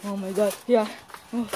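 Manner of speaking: normal talking voice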